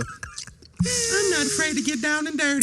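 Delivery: high, slow mocking voice; mocking imitation. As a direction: parrot her words back in a squeaky mock. Mocking Shauna's words.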